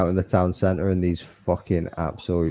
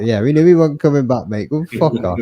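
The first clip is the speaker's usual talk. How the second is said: loud, higher mock yell of fuck off; retold yell; 6 semitones higher, 8 dB louder than usual. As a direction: bark them away, half laughing at how obvious it was. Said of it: "And I was like".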